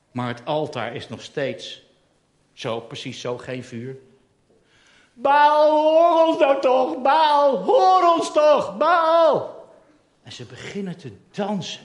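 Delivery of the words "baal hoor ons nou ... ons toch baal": loud, high pleading cry, slow; desperate shouting. Acted. Exhausted priests pleading with Baal, loud high cry. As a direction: beg Baal ever more desperately. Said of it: "Ze schreeuwen".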